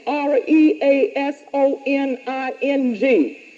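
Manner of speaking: R E A S O N I N G letter by letter; spelled out. Shown as a demonstration. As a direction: spell "R E A S O N I N G" one letter at a time.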